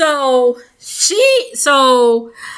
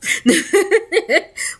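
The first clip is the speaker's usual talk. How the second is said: laugh; 7 semitones higher than usual. Real, not acted. Laughing at the plot twist.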